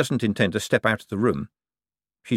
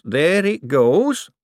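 higher, louder and slow on goes, rising; drawn-out excited repeat; 9 semitones higher, 6 dB louder, much slower than usual. A narrator's reading. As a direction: stretch it out thrilled as the valve goes.

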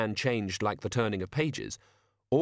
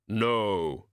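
grim reaper voice. Acted. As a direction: speak as Death, slow and final. Death speaks in story.

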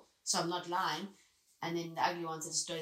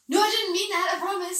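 very high, loud mock panic; hammed-up horrified apology, high and loud; panicked backtracking; 13 semitones higher, 13 dB louder than usual. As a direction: yelp a frantic retraction. Frantically retracting a tactless reply.

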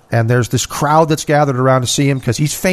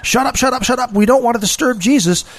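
higher, hissing shut-ups from an embarrassed crowd; the crowd shushing the blind man, higher and annoyed; 8 semitones higher than usual. Retold or imitated. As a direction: scold him to hush, higher and hushed. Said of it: "what they're thinking is".

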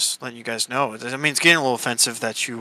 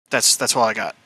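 usual speaking voice

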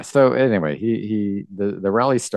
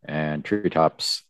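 ordinary delivery

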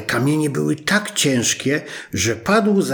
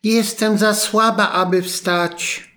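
put-on high, slow old-woman voice; weak old grandmother; 8 semitones higher than usual. Bedridden grandma to the visitor at the door, in a high frail voice. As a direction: sound frail, too weak to get up. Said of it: "rzekła babcia".

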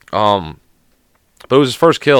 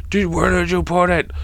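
high, strained yell held down in volume; mock angry yell; 7 semitones higher than usual. Grandson confronts grandma over the missing mannequin, mock yelling. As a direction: imitate yelling without real loudness. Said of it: "he yells at his fucking grandma".

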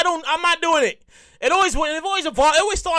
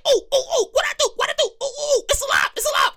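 high, panicked squealing; freaked out; 10 semitones higher, faster than usual. Retold or imitated. Diner to his moving plate, high panicked squeal. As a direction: squeal like food just moved. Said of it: "I would be like".

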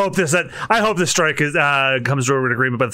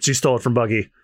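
ordinary delivery

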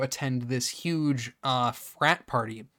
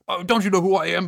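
quick, louder indignant retelling; raised, hurried mock indignation; mock outrage; 5 semitones higher, 5 dB louder, faster than usual. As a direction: imitate an affronted big shot. Acting out outraged guy bumped into.

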